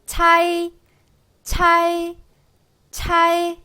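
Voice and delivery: 차이 pitched high, 아 stressed and 이 light; pinyin cai demo. Shown as a demonstration. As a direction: say 차이 crisply three times like a model. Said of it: "이렇게 발음을 해주시면 되겠습니다".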